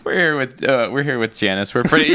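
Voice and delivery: ordinary talk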